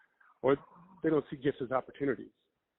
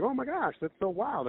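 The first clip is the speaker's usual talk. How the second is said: much higher, gushing retold surprise; amazed; 11 semitones higher than usual. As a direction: squeal it like you can't believe it. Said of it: "I was like".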